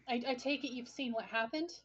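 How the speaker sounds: ordinary talk, nothing marked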